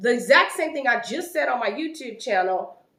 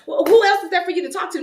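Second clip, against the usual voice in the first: fast, loud, high-pitched mock indignation; indignant challenge; 8 semitones higher, 10 dB louder, faster than usual. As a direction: fire it back, imitating her affronted question. Retells her indignant challenge.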